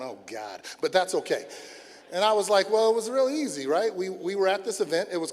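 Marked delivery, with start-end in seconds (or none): 0.0-0.6 imitated eye-roll. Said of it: "she's rolling her eyes going".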